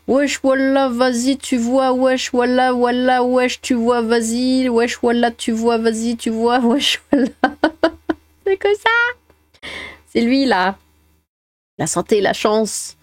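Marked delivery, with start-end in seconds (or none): 0.1-7.3 mocking wesh wallah banlieue voice; imitating a racaille from the suburbs; French suburb street slang. 8.5-9.7 squeaky mock voice. Mock exclamation.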